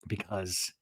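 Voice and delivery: usual speaking voice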